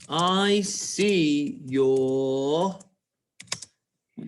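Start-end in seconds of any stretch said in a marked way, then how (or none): 0.1-2.7 very slow, stretched words; word-by-word dictating pace; slow dictation. Shown as a demonstration. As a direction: draw out each word for pupils writing along. Dictating slowly while writing.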